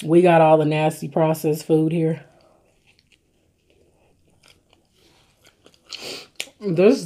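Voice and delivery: eating a burger while talking; talking while chewing. Real, not acted. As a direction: mouth partly full of food. Seen 0: Holds burger at mouth, chewing between words throughout.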